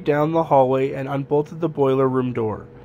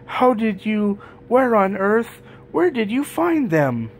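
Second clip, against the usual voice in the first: high-pitched storybook astonishment; amazed; 7 semitones higher than usual. A narrator's reading. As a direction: break in with eager, amazed questions. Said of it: "The elves were amazed".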